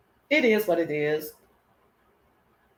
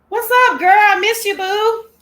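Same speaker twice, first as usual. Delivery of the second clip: much higher, loud, bubbly hello; gushing greeting; 13 semitones higher, 11 dB louder than usual. Acted. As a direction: squeal a big hello to your girl.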